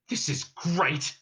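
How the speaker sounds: slow, bitterly sarcastic; Arthur spluttering. A narrator's reading. Prisoner dragged along by a guard, spluttering sarcastically. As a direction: drag it out with exasperation. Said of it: "spluttered Arthur".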